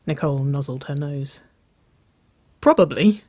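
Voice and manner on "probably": playful, higher and louder teasing word; a chuckling, punched-up reply; chuckled. A narrator's reading. Nicole teasing her girlfriend in her arms, chuckled. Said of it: "she chuckled".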